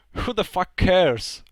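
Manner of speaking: slow, loud, exasperated dismissal. Real, not acted. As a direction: throw it away, fed up and loud. Exasperated rant about grammar tenses.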